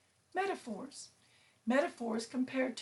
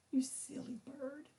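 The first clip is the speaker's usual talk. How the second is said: ordinary talk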